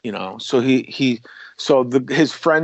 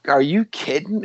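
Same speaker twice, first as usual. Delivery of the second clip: higher-pitched incredulous question; mild mock astonishment, pitched up; retold disbelief; 5 semitones higher than usual. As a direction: react in disbelief to shocking news. Retold shock at news of death.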